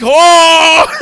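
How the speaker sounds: high loud pained yell, slow; anguished yell. Retold or imitated. Reacting to the slow knife scene with a pained yell. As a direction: cry out in agony at the screen.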